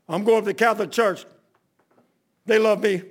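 loud, declaring mimicry of a caller; imitated phone message. Seth leaves a message on the pastor's machine, loud mimicry. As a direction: announce it loudly, in short proud bursts.

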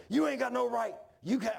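loud, high-pitched objection; angry protest. Retold or imitated. Worker challenges the superintendent's right, imitated angry protest. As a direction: mimic a man itching for a fight. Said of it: "acted like he wanted to fight".

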